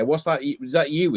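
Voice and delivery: normal talking voice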